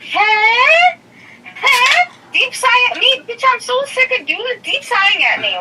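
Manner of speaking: high-pitched, affronted challenge; indignant. Real, not acted. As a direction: go up high, demanding an answer. Daughter challenging father's deep sigh.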